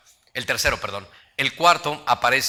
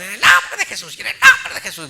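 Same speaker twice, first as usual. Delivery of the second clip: shrill mock shriek, much higher and louder, laughing through it; mock shrieking prayer; 8 dB louder than usual. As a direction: imitate women shrieking a rebuke, half laughing. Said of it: "grita y grita así bien duro". (imitando a las hermanas que gritan en el nombre de Jesús, muy agudo, fuerte y entre risas)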